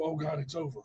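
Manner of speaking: soft, defeated murmur; hushed despair. Retold or imitated. Patient giving up on life, soft despair. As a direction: let it fall out quietly. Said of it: "I go".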